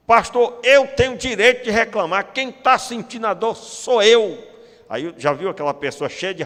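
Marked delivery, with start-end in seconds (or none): 0.1-4.4 louder, higher insistence; mock indignant protest. Member to the pastor, indignantly protesting. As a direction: demand the right to complain.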